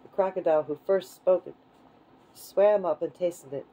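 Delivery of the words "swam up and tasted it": ordinary delivery, nothing marked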